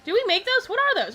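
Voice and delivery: high, loud mimicked child firing questions; interrupting kid. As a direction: imitate a nosy little kid butting in.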